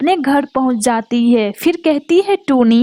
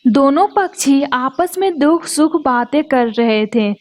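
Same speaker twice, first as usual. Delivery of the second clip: ordinary delivery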